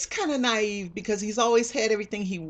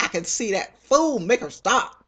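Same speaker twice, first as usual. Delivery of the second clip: louder, snappish imitation of an irritated lord; mock impatient lord; 5 semitones higher, 7 dB louder than usual. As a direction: bark the order like an annoyed nobleman. Lord voiced impatiently in retold scene.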